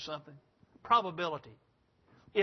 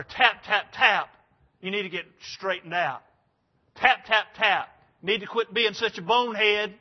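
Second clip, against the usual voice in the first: knocking sounds said loud and punchy, then a mild scold; hammed-up God rapping on the door; a touch of a scolding God; mock knocking; 2 semitones higher, 7 dB louder than usual.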